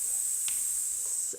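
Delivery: long unvoiced hiss. Shown as a demonstration. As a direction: hiss out steadily on s. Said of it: "and we'll s-, ready, and".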